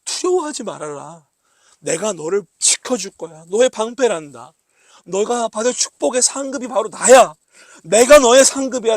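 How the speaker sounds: God's promise to Abraham growing into a cry; rising reassurance. Acted. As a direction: build from tender promise to a ringing declaration. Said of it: "라고 말씀하시는 거예요".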